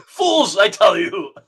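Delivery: high, loud mock-scornful cry; hammed-up Frenchman berating losers; French character calling out. Playing the French person scolding the losers, high loud mock outburst. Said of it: "the French person calls every, all the losers".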